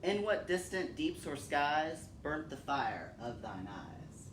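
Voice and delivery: slow, grave poem reading with long pauses; solemn recitation. Acted. Reciting William Blake for her son, solemn measured recitation. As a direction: let each question hang in the air.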